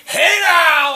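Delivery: very loud, high hype yell; catchphrase shout. Real, not acted. Motivating viewers to keep living, loud high yell. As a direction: belt it to fire up the crowd.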